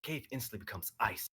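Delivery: ordinary delivery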